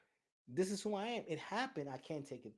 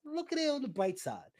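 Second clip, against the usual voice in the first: chirpy bright side; 11 semitones higher, 6 dB louder than usual. Retold or imitated. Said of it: "I was like".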